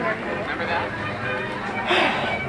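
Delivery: long breathy sigh; soft wistful sigh. Real, not acted. Nostalgia over the building.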